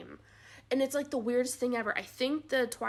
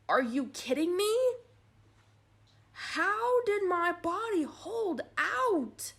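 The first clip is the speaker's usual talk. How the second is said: higher, slow stunned disbelief; 8 semitones higher, 5 dB louder, slower than usual. Retold or imitated. New mother staring at her huge newborn, stunned and slow. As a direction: stretch it out, amazed at the size.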